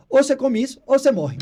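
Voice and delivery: dog threatening its owner over the food bowl; dog's ultimatum. Retold or imitated. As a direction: give the dog a hammed-up warning. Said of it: "o cachorro olhou pra ela e falou".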